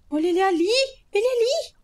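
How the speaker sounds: high, loud scared yell; panicked shout. Real, not acted. Gamer seeing the intruder, yelling to chat. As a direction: point him out in panic.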